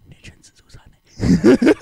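slow, whispered jab; snarky whisper. Real, not acted. Friend teasing about crying to win, slow snarky whisper. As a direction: whisper the dig like a secret.

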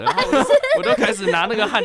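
laughing at his own excuse; can't stop laughing mid-sentence. Real, not acted. (笑着说)

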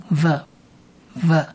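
V said as a buzzing v sound; sound demo. Shown as a demonstration. Teacher demonstrates V sound to listeners, slow sound demo. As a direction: isolate the V sound slowly. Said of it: "在发音上面是发V的音".

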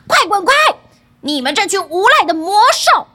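loud, high acted yell; impatient shout. A narrator's reading. Son with a knife driving off demon beasts, impatient shouting. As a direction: shout them away, fed up. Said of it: "就抓起刀子大喊着".

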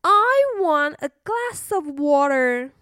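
put-on a child stalling bedtime; little girl voice. A narrator's reading. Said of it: "princess asks for water".

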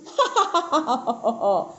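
acted belly laugh, trailing downward; a warrior's scornful ha-ha-ha; big mocking laugh. A narrator's reading. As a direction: laugh heartily at the rival. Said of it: "大笑了三声".

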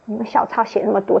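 ordinary delivery

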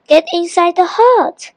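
high-pitched urgent order; mild yell. A narrator's reading. Hassan sends the children into the hut, mildly yelling. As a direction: hurry them with a high call. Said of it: "yelled Hassan".

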